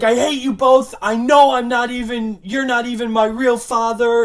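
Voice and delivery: put-on yelled bratty teen lines; loud teen outburst. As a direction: shout it at both parents. Daughter yelling at parents.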